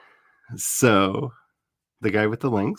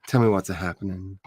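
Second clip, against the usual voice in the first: ordinary talk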